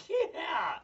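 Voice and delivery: high squeezed "yeah"; mock cheer. Own mock reaction to gory idea.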